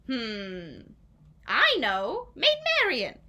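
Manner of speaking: loud, high "Maid Marian" burst; hammed-up eureka; sudden idea. King hitting on his plan, loud high eureka.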